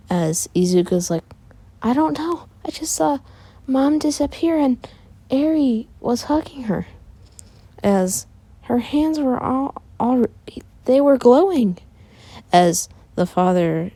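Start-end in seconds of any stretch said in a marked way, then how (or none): 1.8-11.8 put-on high-pitched girl's character voice; bewildered sister. Izuka tells father how Mom disappeared, high and bewildered. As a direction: explain it in a much higher voice.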